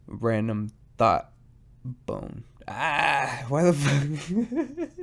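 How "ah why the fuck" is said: real laugh breaking through, very slow; giggling disbelief at himself. Real, not acted. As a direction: laugh it out at your own blunder. Laughs at his own slip.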